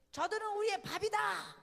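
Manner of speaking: acted battle cry, high and loud; bold defiant shout. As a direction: shout back like a fearless hero. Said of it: "여호수아와 갈렙이 뭐라 그랬어요?".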